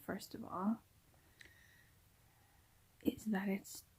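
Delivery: whispering so as not to wake anyone; hushed confession at night; late night whisper. Real, not acted.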